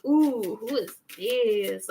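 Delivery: slow, cooing mock interest; drawn-out flirtatious curiosity; mock flirty. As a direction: playfully check someone out. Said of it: "some of you flirt".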